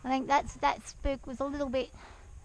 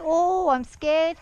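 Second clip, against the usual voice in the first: slow, higher mock-frightened horse voice; voicing the scared horse; 4 semitones higher, 7 dB louder, much slower than usual. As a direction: give the mare a slow, fearful little voice. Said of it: "and she just went".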